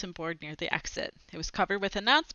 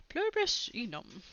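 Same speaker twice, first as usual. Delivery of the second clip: odd little creature voice, softer; 3 semitones higher, 6 dB quieter than usual. Acted. Creature in the backpack answering in Latin. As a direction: squeak out Latin from the bag.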